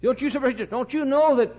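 full-force preaching. Real, not acted. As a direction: preach it hard to the crowd.

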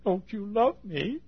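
high, slow, plaintive plea; pleading Jesus. Retold or imitated. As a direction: beg him gently to answer.

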